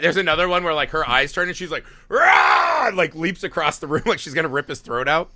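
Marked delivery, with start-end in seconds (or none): 2.1-2.9 feral roar, much higher and louder. Retold or imitated. New mother lunging at her husband with a feral roar. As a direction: let out a wild animal roar at him.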